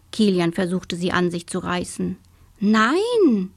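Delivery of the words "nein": put-on higher, drawn girl's no; emphatic childlike protest; defiant refusal. A narrator's reading. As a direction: refuse outright with pitch swooping up. Girl refuses to give up shovel.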